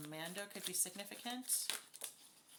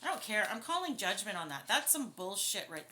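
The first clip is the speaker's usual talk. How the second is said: real indignation, raised voice; getting upset; 2 semitones higher, 6 dB louder than usual. Real, not acted. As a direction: let your outrage spill over. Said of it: "I'm getting upset".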